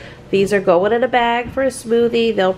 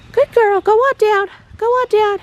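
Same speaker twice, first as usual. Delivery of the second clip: high, louder praise and herding; praising call; 11 semitones higher, 4 dB louder than usual. Real, not acted. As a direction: send the cow down.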